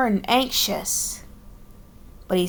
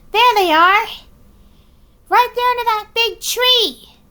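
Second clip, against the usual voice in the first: high, loud excited calling out; excited shout; 12 semitones higher, 5 dB louder than usual. Acted. Character spots the eagles.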